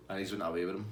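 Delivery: ordinary delivery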